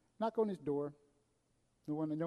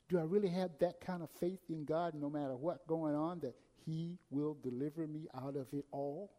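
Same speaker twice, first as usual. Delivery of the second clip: normal talking voice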